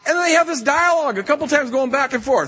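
usual speaking voice

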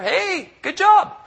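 a coach who does not care, sarcastically chirpy; sarcastic empty praise. Retold or imitated. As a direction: drag out a fake bright compliment. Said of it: "give us a big smile and say".

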